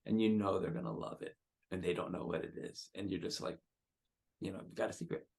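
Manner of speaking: soft, hushed secret for a loved one; gentle, secretive. Shown as a demonstration. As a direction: let the voice drop, holding back a secret. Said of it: "make it kind of gentle".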